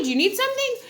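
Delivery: high eager squeak; mock high eager voice; eager offer. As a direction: pitch it way up like an overeager helper. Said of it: "He was like".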